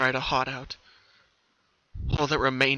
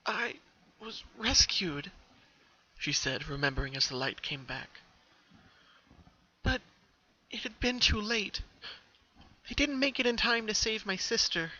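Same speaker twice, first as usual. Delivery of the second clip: sad memory; 8 semitones higher, 4 dB quieter than usual. Acted. Pinky recalls trauma sadly.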